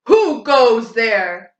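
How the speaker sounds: slow, loud grumbling troll; troll demanding who crosses his bridge; hungry troll grumbling. Acted. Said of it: "grumbled the hungry troll".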